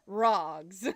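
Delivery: ROGS with a broad British-style ah; mock-British ROGS, amused; British-sounding rogs. Shown as a demonstration. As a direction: try a British accent on ROGS, open ah vowel. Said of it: "almost sounds like a British accent".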